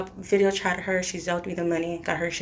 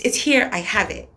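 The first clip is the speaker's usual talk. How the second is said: ordinary delivery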